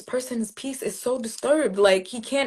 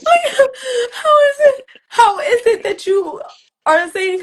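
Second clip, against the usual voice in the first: high, loud, breaking into sobs; real tears as she relives it; crying; 8 dB louder than usual. Real, not acted. Retelling the stranger's blessing, voice breaking with tears.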